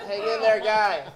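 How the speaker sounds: loud high cheer to a cat; urging the cat. Real, not acted. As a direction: cheer the little guy on. Encouraging the cat.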